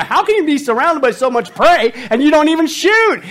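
mock exasperated husband shout; mock hunter outrage. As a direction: bellow it like a baffled hunter. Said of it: "We're going".

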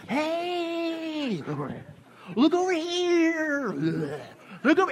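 high, slow, drawn-out call for attention; hammed-up high sign voice calling out slowly; talking sign. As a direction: play a sign begging you to look. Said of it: "and they're going".

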